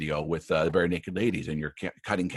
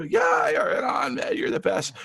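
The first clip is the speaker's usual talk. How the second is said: very high, slow, stretched whoop of praise; cheering shout; slower than usual. Retold or imitated. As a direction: hype him up like a proud coach. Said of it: "And I go".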